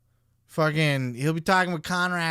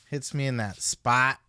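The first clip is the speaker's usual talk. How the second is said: ordinary delivery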